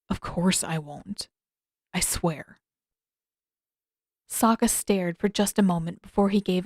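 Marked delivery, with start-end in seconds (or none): 0.1-2.6 slow, softer sincere vow; solemn promise. Acted. Zuko swearing to Sokka to keep Katara safe, solemn. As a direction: promise it quietly and steadily. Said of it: "his expression was solemn and steady".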